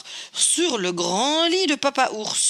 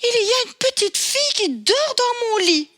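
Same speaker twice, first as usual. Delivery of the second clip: much higher little bear voice, amazed; astonished baby bear; 14 semitones higher than usual. Acted. Baby Bear to his parents about the girl, high and astonished. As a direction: squeak it out in disbelief. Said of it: "s'exclama Bébé Ours étonné".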